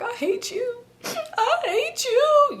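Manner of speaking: high-pitched mock wail, drawn out; whimper, then wail it, hammed up; tearful outburst. Retold crying outburst at boyfriend.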